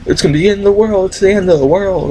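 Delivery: mock crazy preacher; doomsday preacher. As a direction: proclaim doom like a mad preacher. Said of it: "It's like".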